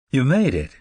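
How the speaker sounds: put-on older Southern woman greeting a guest, mild Southern drawl. A narrator's reading. Hostess welcomes Marcus at the door, light Southern drawl. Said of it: "in a distinctive Southern drawl".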